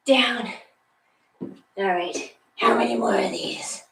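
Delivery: usual speaking voice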